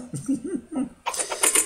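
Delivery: petit rire soufflé, haut perché; glousse, amusé par soi-même; rire aigu soufflé. Real, not acted. Rit de son accent. (rire soufflé et amusé)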